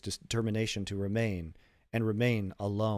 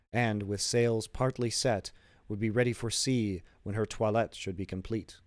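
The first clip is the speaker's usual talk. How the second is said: plain reading voice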